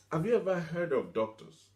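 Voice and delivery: usual speaking voice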